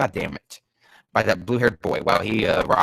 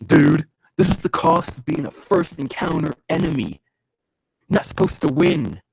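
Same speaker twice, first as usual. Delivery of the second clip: higher, worked-up declaration; pitched-up, forceful delivery; emphatic taunt; 5 semitones higher, 4 dB louder than usual. Acted. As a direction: proclaim it at the beaten foe. Repeats the line worked up.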